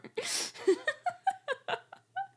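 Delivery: loud high cackle; loud high laugh; high burst of laughter. Real, not acted. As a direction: crack up loudly.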